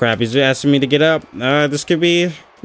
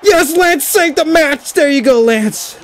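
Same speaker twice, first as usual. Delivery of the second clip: relieved cheer; 9 semitones higher, slower than usual. Real, not acted. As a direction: cheer the rescue. Partner saves the match.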